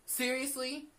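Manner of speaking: usual speaking voice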